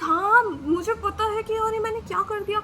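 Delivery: admitting the mistake, wailing; remorseful wail. Retold or imitated. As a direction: wail the regret out. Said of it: "I was like".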